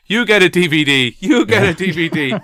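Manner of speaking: high, loud giveaway shout, laughing through it; Oprah. Acted. Host parodying a studio giveaway, excited high shouting. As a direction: whoop each line like a talk show host handing out prizes. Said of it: "it's like Oprah".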